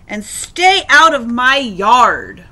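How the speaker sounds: loud, emphatic storybook order; yelling back. A narrator's reading. Hank chasing the jackrabbit off his yard, yelling back. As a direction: warn off an intruder loudly. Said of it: "yelled back".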